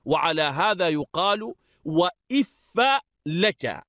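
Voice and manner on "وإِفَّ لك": وإف said "wa-iffa", i first, a last; kasra then fatha. Shown as a demonstration. Teacher showing students وإف as "wa-iffa", i then a. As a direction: open وإف with "if", end on "-fa". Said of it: "بكسر الألف وفتح الفاء".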